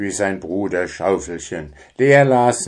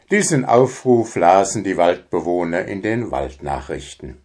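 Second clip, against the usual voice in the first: ordinary delivery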